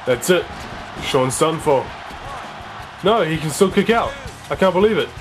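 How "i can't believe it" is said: normal talking voice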